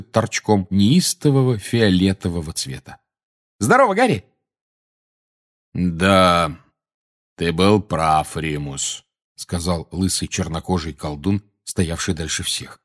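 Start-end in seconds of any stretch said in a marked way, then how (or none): 3.6-4.2 put-on high-pitched young woman's hello; lively young witch, pitched up high; young witch's greeting. A narrator's reading. 5.7-9.0 slow, thick bass drawl; deep-voiced wizard drawling slowly. A narrator's reading. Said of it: "Говорил он в растяжку, густым басом".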